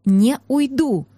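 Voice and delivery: slow, word-by-word refusal; deliberate pauses, stubborn defiance; defiant, through teeth. A narrator's reading. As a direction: force it out through gritted teeth. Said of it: "процедила ему в лицо".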